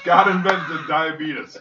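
loud, high, slow holler; shouted catchphrase. Acted. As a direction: bellow it slowly as a running gag. Joins the mock catchphrase montage.

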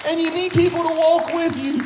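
rousing pulpit exhortation with shouts; preaching at full force. Acted. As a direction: drive it home to the congregation.

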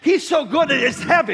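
high, loud preacher's cry; shouted preaching. Acted. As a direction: preach it full force. Preaching at full force.

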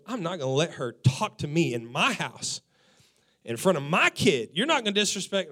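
loud, firm outburst; angry dad. Retold or imitated. Husband vows to shut down wife's disrespect, loud. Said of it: "he told me".

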